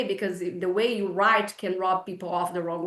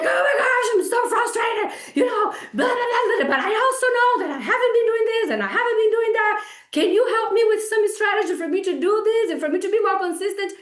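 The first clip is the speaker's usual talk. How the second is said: much higher and louder, bubbly and laughing; gushing excited client; 10 semitones higher, 4 dB louder than usual. Retold or imitated. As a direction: play the client who owns her slip-ups, eager. Voicing a client's friendly request.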